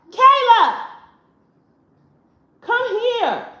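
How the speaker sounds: high, loud call to someone far off. Real, not acted. Mother calling her daughter over from another room. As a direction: summon her from across the house.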